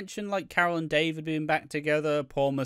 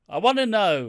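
insistent; 6 semitones higher, 7 dB louder than usual. Retold or imitated. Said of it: "Yeah, but I wanna know".

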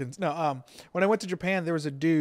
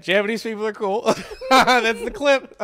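laughing; 4 semitones higher, 7 dB louder than usual. Real, not acted. Laughing at the soundbite.

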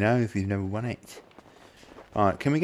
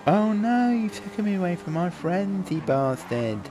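mock distress; 7 semitones higher than usual. Kidnapped player protests.

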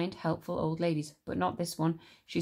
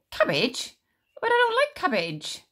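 much higher, louder shocked complaint; dismayed boy; 13 semitones higher, 6 dB louder than usual. Acted. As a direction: recoil from the word cabbage. George dismayed.

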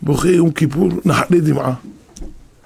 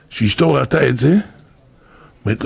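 usual speaking voice

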